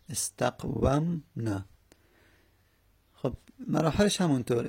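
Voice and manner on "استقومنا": slow spelled-out word. Shown as a demonstration.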